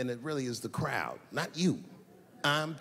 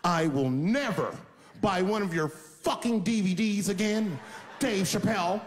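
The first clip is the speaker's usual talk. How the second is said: louder, spiteful mock rant; angry parting dig; 5 semitones higher, 3 dB louder than usual. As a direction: spit it at him on the way out. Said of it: "take one last dig at me on the way out".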